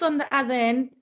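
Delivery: ordinary talk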